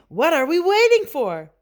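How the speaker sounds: high, loud kitten impatience; eager urging. A narrator's reading. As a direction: push the gang to get going. Kitten urges friends onward.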